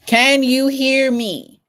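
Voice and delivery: slow, loud, high call into the mic; drawn-out loud mic check. Real, not acted. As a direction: check if anyone can hear. Mic check after restart.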